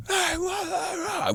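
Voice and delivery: high, garbled yell, held back in volume; mock distant yell. Storyteller imitates the stranger they just hear, mock yelling. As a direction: imitate somebody yelling unintelligibly far off. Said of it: "like, a yell, like, yelling".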